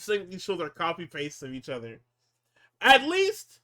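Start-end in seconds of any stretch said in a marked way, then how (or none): 2.8-3.6 exclaimed. Real, not acted. Exclaiming in recap.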